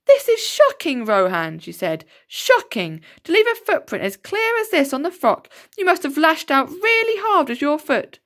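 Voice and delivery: high, shocked schoolteacher voice; teacher scolding Rohan over the footprint. A narrator's reading. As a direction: tell the boy off, appalled.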